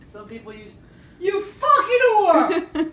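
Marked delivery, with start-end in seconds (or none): 1.2-2.9 high, slow, laughing fake yell; mock shout. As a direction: bellow the insult as a joke. Joking insult mid-recipe.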